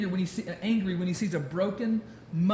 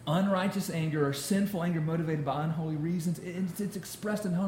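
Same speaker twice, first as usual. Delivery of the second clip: ordinary delivery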